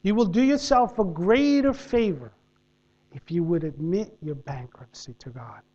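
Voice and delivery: soft, low preaching with long pauses; sermon delivery. Acted. Preacher applies the story to the congregation, soft and low. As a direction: draw the congregation in quietly.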